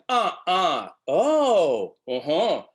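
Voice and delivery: high sing-song wordless grunts; wordless tune of questions; melodic grunts. Shown as a demonstration. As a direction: talk in melody, no words. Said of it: "pre-linguistic melodic ways".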